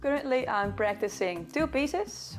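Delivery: ordinary delivery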